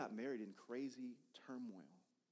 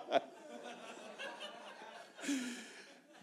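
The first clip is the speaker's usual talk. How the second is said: breathy real laughter; 3 dB louder than usual. Real, not acted. Long laugh during the story.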